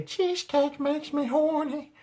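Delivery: very high, drawn-out cartoon woman voice; high-pitched cartoon voice. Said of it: "She could do that high-pitched voice".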